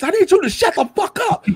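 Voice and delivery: very high, exasperated yell back; retold yell.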